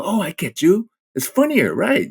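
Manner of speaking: high, breezy imitation of a friendly director; imitating the director. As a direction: chirp it like an agreeable director. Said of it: "They go".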